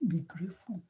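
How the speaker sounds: soft whisper of Be grateful; hushed urging to be thankful. Real, not acted. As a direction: breathe it to the listener. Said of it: "whispered exhortation".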